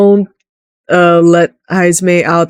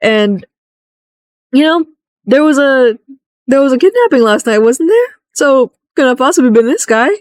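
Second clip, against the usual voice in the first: sarcastic correction; 7 semitones higher than usual. Retold or imitated. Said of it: "It's like".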